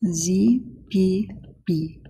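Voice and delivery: slow, drawn-out French accent. Shown as a demonstration. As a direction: say the letters like a Frenchman. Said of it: "I like to do a French accent".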